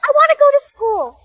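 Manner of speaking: put-on high, loud childish plea; child's loud protest. Kids shout back at the mean father. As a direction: insist like a stubborn kid.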